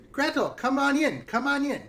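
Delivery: high, singsong witch invitation; beckoning witch. Acted. Witch calls Gretel into the house, high and coaxing. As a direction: coax in a high, falling coo.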